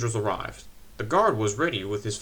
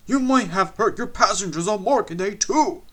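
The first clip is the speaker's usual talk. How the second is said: louder, higher stern scolding; 8 semitones higher, 7 dB louder than usual. A narrator's reading. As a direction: tell them off loudly. Skarloey scolding the coaches.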